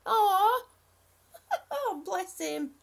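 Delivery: loud, high, tender aw; heartfelt aw to chat; touched aw. Real, not acted. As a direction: melt at the news. Moved by chat news.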